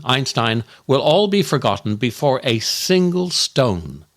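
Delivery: ordinary talk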